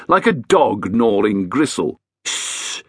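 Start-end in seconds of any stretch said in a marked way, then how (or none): 2.2-2.8 sharp unvoiced shush; whispered shh; hissed shush. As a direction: hush them with a hiss. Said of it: "Edith hissed".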